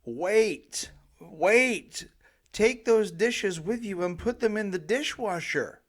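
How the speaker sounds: loud, high calls of wait; screaming after them. Retold or imitated. Parent yelling at kids leaving to take the dishes. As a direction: yell after the kids as they leave. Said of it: "I used to scream as they were leaving".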